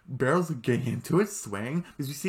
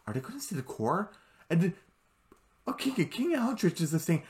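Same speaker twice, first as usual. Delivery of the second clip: ordinary talk, nothing marked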